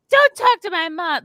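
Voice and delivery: hammed-up defensive mom; mock mom snapping. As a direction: imitate the mother shrilly cutting in. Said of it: "the mom is like".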